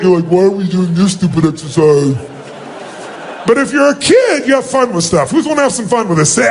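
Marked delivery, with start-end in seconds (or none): 0.3-2.2 lower, sullen adult voice; grumbling adult. Retold or imitated. Speaker imitates an adult resenting the exercise, grumbling. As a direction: complain like a jaded grown-up. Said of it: "If you do it like an adult".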